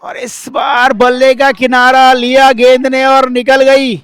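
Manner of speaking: high, loud play-by-play; excited cricket commentary. Real, not acted. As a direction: call the edge as it races away. Edge runs away for four.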